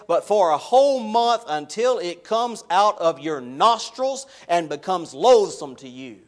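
loud, building list of days, slow at the end; preaching at full force. Acted. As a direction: hammer each step harder to the congregation. Builds God's warning at full force.

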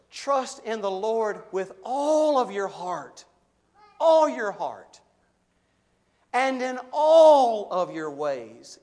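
"all" loud and slow; stress on ALL. Real, not acted. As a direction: punch "all" every time. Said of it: "did you notice the extent of the verse".

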